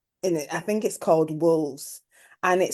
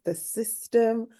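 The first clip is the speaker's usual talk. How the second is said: normal talking voice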